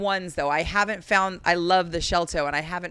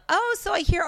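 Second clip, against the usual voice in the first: nosy interviewer; 12 semitones higher than usual. Retold or imitated. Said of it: "she had the balls to say".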